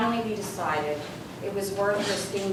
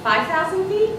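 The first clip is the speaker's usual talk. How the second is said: high, louder, rising hopeful question; hopeful guess; 11 semitones higher, 5 dB louder than usual. Retold or imitated. Hopefully guessing the altitude to her instructor. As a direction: ask it hoping.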